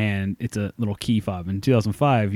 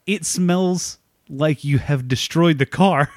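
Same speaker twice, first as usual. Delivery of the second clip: slow, drawn-out deadpan verdict, pitched up; deadpan salesman; 4 semitones higher, slower than usual. Retold or imitated. Salesman telling the driver the car smells, slow deadpan. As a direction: drag each word out like grim news. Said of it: "He's like".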